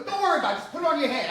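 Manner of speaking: imitating an impatient director on set; retold brusque reassurance. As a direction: loudly wave the complaint away. Retold brush-off to complaining actor.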